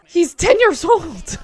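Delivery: loud, high, slow exclamation; exasperated outburst. Real, not acted. Reacting to the episode with a loud, high, disbelieving shout. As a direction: shout your disbelief at the screen.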